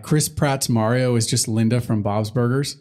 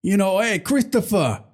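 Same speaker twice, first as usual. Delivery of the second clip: terrible Tony Soprano; 3 semitones higher than usual. Retold or imitated. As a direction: do a bad Sopranos boss. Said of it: "My terrible Tony Soprano impression".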